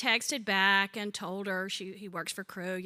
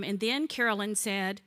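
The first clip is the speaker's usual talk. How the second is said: usual speaking voice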